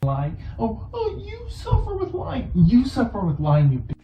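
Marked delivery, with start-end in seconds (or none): 0.6-2.5 high, whiny mock voice; hammed-up whine; mock wife. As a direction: whine it like a fake victim.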